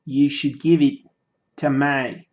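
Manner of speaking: lightly put-on Australian accent; amateur mock-Australian accent. Shown as a demonstration. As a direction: try an Aussie accent in separate, deliberate phrases. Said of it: "your Australian accent changed my mind".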